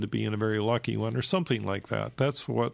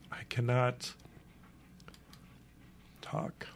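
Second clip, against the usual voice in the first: really running out of breath and voice; 8 dB quieter, slower than usual. Real, not acted. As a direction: voice giving out into a whisper. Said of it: "I'm running out of breath here".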